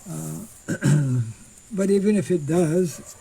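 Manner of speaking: usual speaking voice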